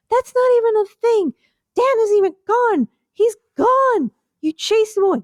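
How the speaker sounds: the first shoe fights for its turn; first shoe, bickering. Pretend. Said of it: "I'm a shoe".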